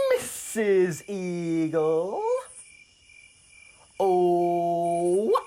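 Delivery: loud, high, very drawn-out frog voice; curious frog. Acted. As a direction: stretch every word into a big booming question.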